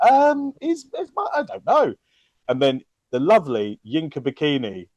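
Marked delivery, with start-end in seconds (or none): 0.1-1.9 high, hesitant, puzzled mumble; mock confused friends. Retelling friends' puzzled reply, high and hesitant. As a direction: act baffled, voice up high. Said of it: "were like".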